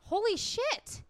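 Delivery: high, loud shocked outburst. Real, not acted. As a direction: gasp it out in disbelief. Shocked at anniversary.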